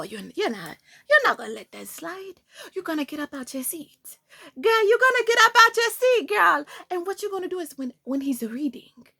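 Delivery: put-on Jamaican accent, whispered goading that flares up in bursts; put-on Jamaican accent, egging on conspiratorially; Revenge [name]. Revenge self urging her to hit back, Jamaican accent. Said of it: "with my Jamaican self".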